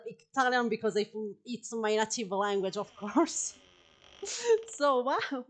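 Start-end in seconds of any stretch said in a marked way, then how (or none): none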